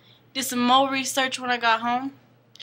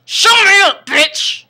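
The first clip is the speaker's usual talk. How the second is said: loud, high outburst; mock angry yell; 10 semitones higher, 12 dB louder, slower than usual. Storyteller acts out the girl's retort to the vampire, loud mock-angry yell. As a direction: yell it as a sudden comeback.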